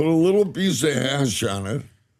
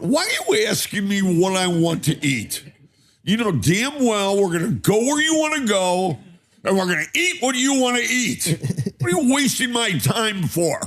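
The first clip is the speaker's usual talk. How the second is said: acted exasperated rant, higher pitched; hangry outburst; 8 semitones higher than usual. Hungry husband blows up at wife over dinner choice, exasperated. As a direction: retell blowing up, pitch climbing on each complaint. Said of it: "I didn't handle myself too well".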